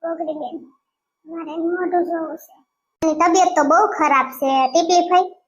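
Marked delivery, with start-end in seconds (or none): 0.0-2.5 faint plea. Acted. Dying girl begs faintly.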